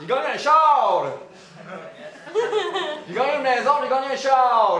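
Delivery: crie sa victoire, plus fort et plus aigu; cri de joie. Acted. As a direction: hurle de joie d'avoir gagné. Wins a car, overjoyed. (exulte à pleine voix)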